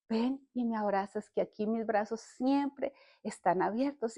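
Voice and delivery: tender invitation. Retold or imitated. Holy Spirit invites her into open arms, tenderly. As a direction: invite gently, almost hushed.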